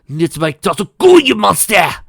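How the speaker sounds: loud high furious scream. Acted. Parents screaming at Divine. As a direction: scream at the monster. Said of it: "screamed in unison".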